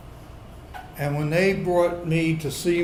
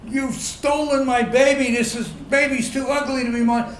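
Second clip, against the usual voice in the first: bellowing; 8 semitones higher than usual. Retold or imitated. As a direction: bellow the accusation like an outraged father. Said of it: "I just bellow at him".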